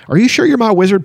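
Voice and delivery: ordinary talk, nothing marked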